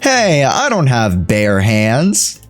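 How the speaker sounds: ordinary talk, nothing marked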